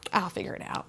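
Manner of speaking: whispered I'll figure it out, flat and resigned; quiet unvoiced self-talk; whispered resignation. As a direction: say it under your breath, alone. Repeated self-reliant resignation.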